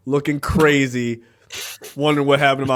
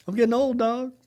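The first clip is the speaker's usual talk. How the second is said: higher, softer rueful cry; mock dismay; 10 semitones higher, 4 dB quieter than usual. Retelling his own reaction to time passing, higher and rueful. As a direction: say it high and dismayed. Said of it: "I was like".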